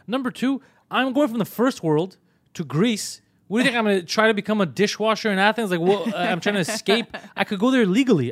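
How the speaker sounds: high, loud, exasperated retelling; indignant arguing. Retold or imitated. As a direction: argue the points with comic outrage. Retold indignant argument with airline staff.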